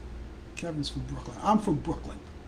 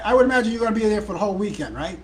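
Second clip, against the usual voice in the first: louder, fast, laughing through the words; laughing tease; 4 semitones higher, 6 dB louder, faster than usual. Real, not acted. Teasing guess about long weekend.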